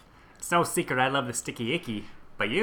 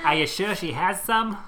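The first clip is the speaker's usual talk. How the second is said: put-on old lady voice; 7 semitones higher than usual. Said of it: "Oh, that's funny".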